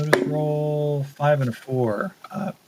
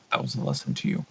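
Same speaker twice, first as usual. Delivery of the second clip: menacing captor; 11 semitones lower, 5 dB quieter, much faster than usual. Acted. The captor warns a prisoner after a jolt, in a low cold voice. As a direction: lean into the threat like a torturer.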